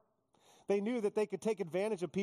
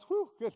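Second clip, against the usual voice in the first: mock relieved whew; 9 semitones higher, 4 dB louder than usual. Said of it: "Everybody goes".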